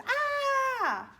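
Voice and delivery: loud, high storybook scream; hammed-up shriek of a frightened girl; acted scream. Little Red screams at the wolf.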